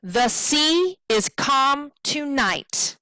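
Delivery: slow, stressing "sea", "calm" and the end of "tonight"; iambic stress. Shown as a demonstration. Teacher reading the scanned line, slow stress on "sea" and "calm". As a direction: lean on every second syllable of "The sea is calm tonight". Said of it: "now we have our stressed and unstressed".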